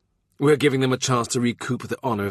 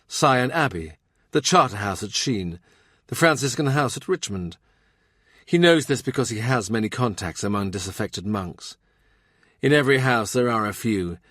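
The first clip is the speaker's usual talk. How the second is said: usual speaking voice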